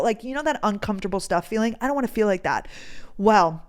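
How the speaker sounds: ordinary talk, nothing marked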